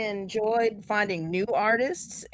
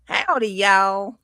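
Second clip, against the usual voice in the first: slow Texas twang; drawn-out, folksy Southern twang; twangy drawl; slower than usual. Acted. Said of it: "with that twang".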